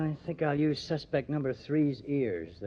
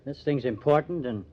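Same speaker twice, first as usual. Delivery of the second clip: ordinary talk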